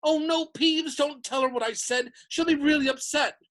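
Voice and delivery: high, urgent pleading; frantic. A narrator's reading. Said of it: "Hermione whispered frantically".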